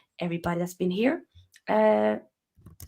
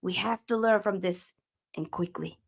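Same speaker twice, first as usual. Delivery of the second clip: put-on Dutch manager's accent, measured and emphatic; Ten Hag; 3 semitones higher, 4 dB louder than usual. Manager rallying his beaten team, Dutch-accent impression. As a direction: deliver a deliberate pep talk in a Dutch accent. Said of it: "Ten Hag".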